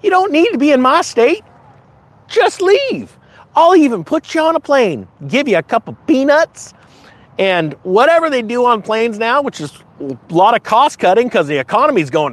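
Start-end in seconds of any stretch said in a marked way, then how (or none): 0.0-6.7 high, brisk mock shooing; mock governor. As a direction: shoo them off, pitched up. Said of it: "DeSantis was trying to do is like".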